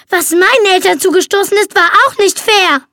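high, loud shriek of bitter rage; a furious screeched retort. A narrator's reading. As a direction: shriek it back at them. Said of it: "kreischte Justine".

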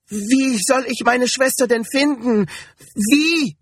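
very high, distraught questioning; panicked high-pitched plea; desperate pleading. A narrator's reading. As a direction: beg for an answer, voice climbing high. Desperate woman pleading with the man.

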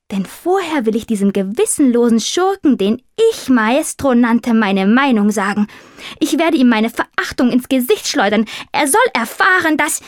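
high, indignant tomcat building in fervour; grand, rising outrage, cut off at the end; pompous tomcat. Acted. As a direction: declaim it like a self-styled avenging hero.

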